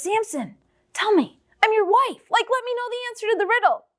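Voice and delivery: high, fast wheedling plea; rising and rushing, coax him for the answer; a wife begging her husband for the secret; pleading wife. Acted.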